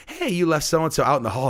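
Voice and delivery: mock kid piping up to the teacher; kids calling out. Pupils remind teacher of kid in hall, imitated call.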